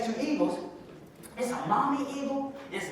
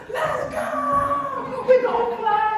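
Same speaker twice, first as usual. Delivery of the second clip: high, loud excited cry of little birds; excited eaglets; 6 dB louder, much slower than usual. Acted. Eaglets cheering about their first flight, high and excited. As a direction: shout it like kids who can't wait. Eaglets thrilled to learn flying.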